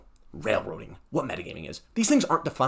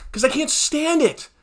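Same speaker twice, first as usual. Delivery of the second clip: ordinary talk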